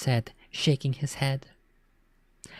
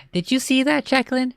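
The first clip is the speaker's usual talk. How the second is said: much higher, gloating call; gleeful cry; 10 semitones higher than usual. A narrator's reading. Girl gloating to imaginary friend, high excited cry. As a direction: crow it to your friend. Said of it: "she cried".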